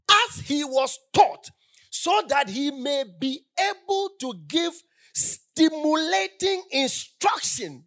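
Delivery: loud, slow sermon emphasis, hammering each word; preaching at full force. Real, not acted. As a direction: proclaim it to the church with full force.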